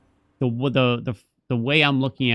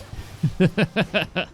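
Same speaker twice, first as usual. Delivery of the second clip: higher-pitched run of chuckles; amused laugh; 8 semitones higher than usual. Real, not acted. Laughing about wife giving up on him, high chuckle. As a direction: laugh at your own failing. Laughs at his own failure.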